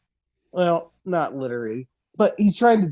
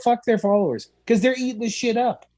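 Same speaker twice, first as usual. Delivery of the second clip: ordinary talk, nothing marked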